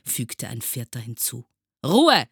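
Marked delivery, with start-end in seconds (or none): 1.9-2.3 loud, high teacher's shout; shouted command. A narrator's reading. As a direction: yell for order. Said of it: "schrie Herr Groll noch einmal". (laut geschrien)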